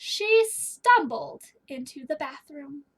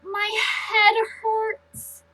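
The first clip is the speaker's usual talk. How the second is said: slow, drawn-out moan; 5 semitones higher, 5 dB louder, much slower than usual. Acted. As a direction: drag the words out in misery. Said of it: "she moaned".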